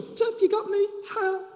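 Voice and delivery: high-pitched gloating retort; mock taunt. Leah to her unwilling new husband, taunting. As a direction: play it as a cheeky "too bad for you".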